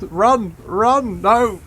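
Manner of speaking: high, loud panicked shouts at fleeing troops; panicked yelling. Real, not acted. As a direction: yell in real panic as the army breaks. Units fleeing in battle, panicking.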